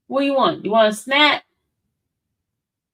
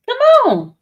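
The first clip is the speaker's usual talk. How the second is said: eager high call; 10 semitones higher, 6 dB louder than usual. Real, not acted. Coaxing the dog upstairs.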